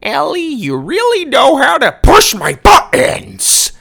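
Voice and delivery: starts high and friendly, ends loud and slow in fury; villain's friendly tone turning to rage. A narrator's reading. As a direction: sweetness boiling over into rage. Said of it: "friendly tone always changed to rage".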